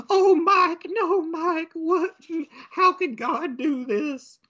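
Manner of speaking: very high hammed-up wail; mock-sob over the dead husband; wailing widow. Wife finds her dead husband, high mock wail.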